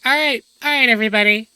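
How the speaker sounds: high, loud hammed-up call; mock loud herding. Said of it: "And Ren is like".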